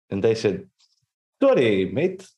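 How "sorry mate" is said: mock British "Sorry, mate"; a cheeky British mate; British accent. Reading the husband's reply in a mock British accent. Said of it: "both were amazing".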